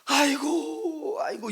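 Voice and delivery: high, drawn-out wailing 아이고; groaning lament. Shown as a demonstration. Pastor showing how the Spirit laments, a long high wail. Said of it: "탄식이 뭡니까?".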